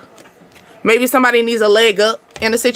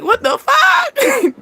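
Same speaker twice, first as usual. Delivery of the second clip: outraged disbelief; 13 semitones higher, much slower than usual. Retold or imitated. Learning the date's snake is big.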